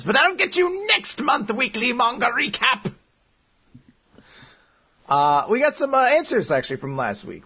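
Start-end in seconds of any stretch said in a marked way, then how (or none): 0.0-2.9 high, slow vengeful villain; villain vow. Retold or imitated. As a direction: swear revenge like a cartoon villain. Villain vows revenge.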